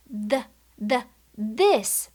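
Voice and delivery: buzzing "The, the, this" with the tongue on the teeth; voiced th demo. Shown as a demonstration. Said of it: "For this sound".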